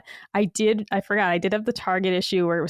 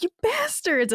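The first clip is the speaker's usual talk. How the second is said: high mock curse; 10 semitones higher than usual. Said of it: "And I was like".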